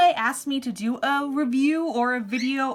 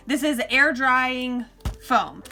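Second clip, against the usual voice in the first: usual speaking voice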